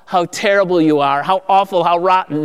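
lightly voiced condemning tone; a hint of a harsh judge tutting; half-hearted mock reproach; mock scolding. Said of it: "That's not from the Lord".

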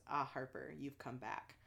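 low, soft, a hint of a monster. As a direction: murmur it low like a beast. The monster greets Harper.

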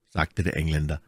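usual speaking voice

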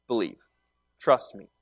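calm, tender promise; quiet reassurance. Retold or imitated. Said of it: "All he has to do is say".

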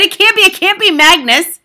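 loud, high panicked denial; frantic shouted protest; crying out. A narrator's reading. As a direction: wail it in horrified disbelief. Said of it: "she cried".

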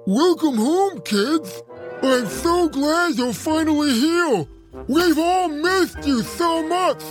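high, slow, gushing welcome; overjoyed to finally see them; excited table voice. A narrator's reading. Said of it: "really excited to see the kids".

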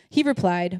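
ordinary talk

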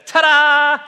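put-on high, loud, bright child's ta-da; little girl's cheer. A little girl answers the Sunday school teacher with a loud, high, gleeful ta-da. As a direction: shout it like a delighted little girl showing off. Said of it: "a little girl in his class said".

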